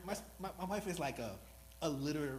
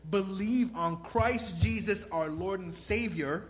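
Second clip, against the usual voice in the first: slow, loud, emphatic preaching; loud, slow altar call to the congregation; 6 semitones higher, 12 dB louder, slower than usual. Real, not acted. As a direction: plead it out slowly and loudly.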